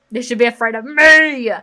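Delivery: loud, aggressive challenge; acted aggressive boast. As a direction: play it bold and aggressive. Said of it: "I don't think she should be that aggressive".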